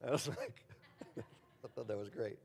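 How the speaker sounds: softly laughing through words. Real, not acted. Laughing at friend's reply.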